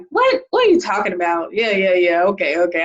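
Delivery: mock disbelief sinking into low, dismissive okays; sarcastic brother. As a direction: imitate a sarcastic brother waving it off. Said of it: "He's sarcastic".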